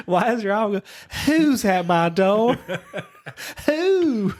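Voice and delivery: high, slow owl voice, laughing through it; mock the wife's owl, laughing. Husband teasing his wife's owl reading, laughing in a high owl voice. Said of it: "And I'm like".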